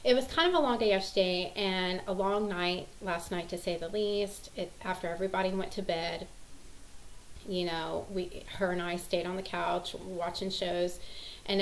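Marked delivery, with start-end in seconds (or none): none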